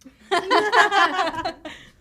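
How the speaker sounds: high, loud laugh; big uncontrolled cackle; real burst of laughter. Real, not acted.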